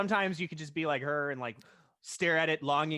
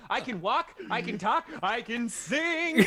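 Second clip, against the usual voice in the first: giddy mock hero; 6 semitones higher, slower than usual. Mocking Steve's revived joy.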